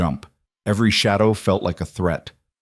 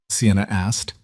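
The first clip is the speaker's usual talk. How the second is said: usual speaking voice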